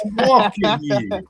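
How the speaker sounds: hammed-up New York movie accent. Shown as a demonstration. Host demonstrating his accent, hammed-up New York accent. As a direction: bark it like a mock New Yorker. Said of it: "I can do a New York accent pretty good".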